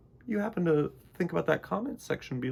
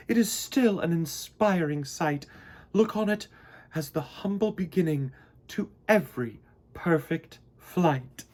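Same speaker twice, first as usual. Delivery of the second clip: stately queen; 4 semitones higher than usual. Acted. Queen bee honoring the old bucket for future bees. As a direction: speak it like a wise queen. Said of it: "said the queen bee".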